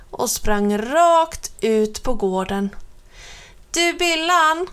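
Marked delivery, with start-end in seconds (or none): 3.7-4.7 eager shout; calling out. A narrator's reading. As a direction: call to Billan from the yard. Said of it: "ropade han".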